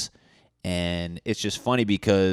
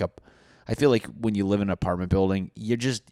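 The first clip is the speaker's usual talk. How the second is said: ordinary talk, nothing marked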